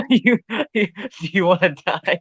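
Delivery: laughing mock threat, squeaky high; high-pitched laughing echo.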